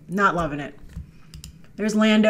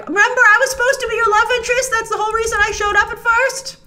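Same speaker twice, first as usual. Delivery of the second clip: much higher, fast, complaining; mock whiny Finn; 13 semitones higher, 4 dB louder, faster than usual. Finn whining to Rey about being the love interest. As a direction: squeak it out like a jilted sidekick. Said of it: "there's Finn being like".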